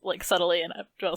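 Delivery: ordinary talk, nothing marked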